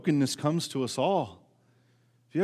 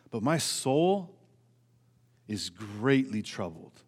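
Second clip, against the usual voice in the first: slow hushed reading; 7 semitones lower, 6 dB quieter, slower than usual. Shown as a demonstration. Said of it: "Do you see how he made it worse?".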